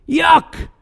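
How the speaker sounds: a big theatrical yuck to the room; shouted disgusted yuck. Acted. Disgust repeated louder.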